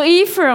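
Efron said "EE-fron"; others' pronunciation. Retold or imitated. Reporting how some people say it, Efron as "EE-fron". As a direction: start Efron on a long "ee". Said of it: "já vi gente falando".

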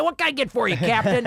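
a hint of a hearty back slapper; lightly voiced glad-handing schmoozer; back slapper guy. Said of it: "The big back slapper guy".

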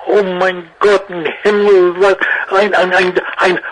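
gushing high German accent, hammed up; raving over the dog in a thick put-on German accent. Actor gushing over a producer's German Shepherd, hammed-up German accent. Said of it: "I had to do the accent".

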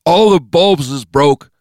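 slow, loud, high-pitched thug; high, loud goon voice; first gunman's voice. Acted. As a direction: drag it out loudly in a high character voice.

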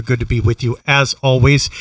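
normal talking voice

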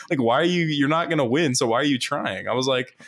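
usual speaking voice

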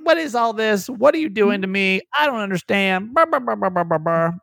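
high, hammed-up ranting at God; a playful half-serious tantrum aimed upward; mock angry prayer. Retelling last night's prayer, mock-ranting at God. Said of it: "I'm like".

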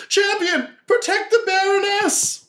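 high, slow panicked cry from the crowd; crowd shout. Acted. Wedding guests crying out to the champion, high alarmed shout. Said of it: "A lot of people are like".

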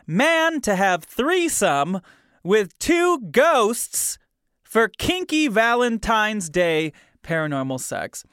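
dramatic headline read. Acted.

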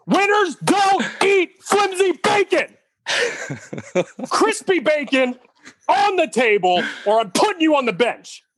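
high, slow hammed-up yell breaking into a laugh; mock coach yell. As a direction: bark it like a coach chewing out a player over bacon. Hammed-up rant about flimsy bacon.

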